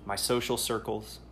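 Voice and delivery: ordinary talk, nothing marked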